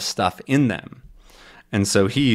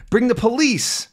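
voiced Tintin; 13 semitones higher than usual. Tintin's line requoted.

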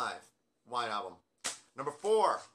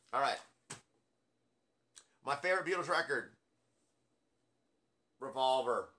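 ordinary delivery

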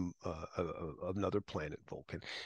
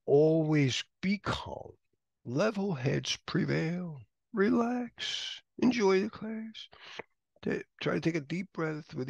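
slow, soothing reassurance; slow it right down to calm the students; teacher soothes exam takers, slow and calm; 6 semitones higher, 3 dB louder, slower than usual. Real, not acted. Calming exam advice.

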